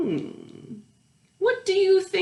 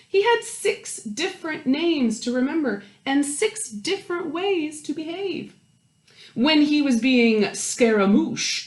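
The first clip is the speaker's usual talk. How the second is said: lively storytime read, lingering on the counting; reading to children; 4 dB louder, slower than usual. A narrator's reading. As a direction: read it aloud to a circle of kids, savouring each house. Reading picture book to children.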